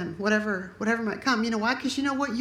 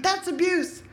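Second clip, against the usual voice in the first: high, mildly scandalised gasp; mock objection; 9 semitones higher, slower than usual. Pastor voicing a shocked congregation member, mock high. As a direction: pitch it up like a shocked listener. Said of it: "some of you might think".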